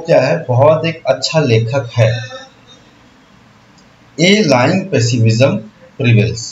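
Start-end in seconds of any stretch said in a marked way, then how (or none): 4.2-6.4 slow reading aloud. Shown as a demonstration. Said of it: "teacher rereads sentence".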